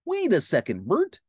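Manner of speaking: cartoon pal, jumping high on the name; Ernie. Ernie halts Bert before the bath, high cartoon voice. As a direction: stop him, voice leaping up. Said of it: "Ernie said".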